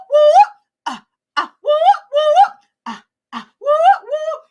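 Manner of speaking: high, loud repeated whoop; celebratory whoops. Shown as a demonstration. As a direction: whoop it up in bursts to welcome viewers. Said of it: "like to give you a whoop".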